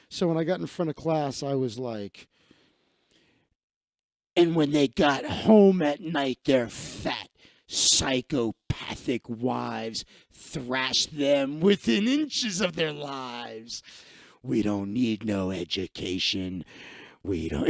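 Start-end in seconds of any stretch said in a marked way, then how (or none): 4.3-13.8 slow, overdone theatrical recital; dramatic performance. Retold or imitated. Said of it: "I want you to perform it when you do it". 14.4-17.7 lower, hammed-up lyric recital; dramatic performance. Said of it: "I want you to perform it when you do it".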